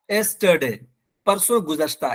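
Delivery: ordinary delivery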